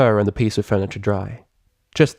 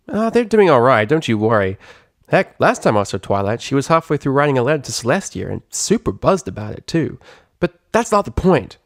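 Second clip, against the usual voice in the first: higher, cocky tomboy pegasus voice; Rainbow Dash, brash; 4 semitones higher than usual. Acted. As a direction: tease her like a sporty show-off.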